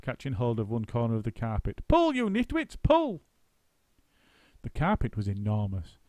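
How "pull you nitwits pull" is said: high, louder heaving order; high loud heave-ho; straining shout. A narrator's reading. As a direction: heave and yell together. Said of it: "give me a hand".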